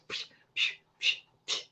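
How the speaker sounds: soft unvoiced puffs of breath; nervous breath puffs. Shown as a demonstration. Host shows viewers how she puffs out nerves before air. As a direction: blow out the nerves in short puffs. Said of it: "getting out, like, my nervousness".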